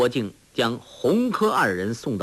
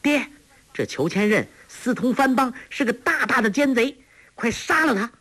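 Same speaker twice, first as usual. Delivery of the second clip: put-on girl urging her father; 11 semitones higher than usual. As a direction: push her father to strike. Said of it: "黄蓉又说".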